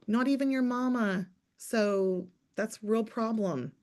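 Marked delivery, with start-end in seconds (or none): none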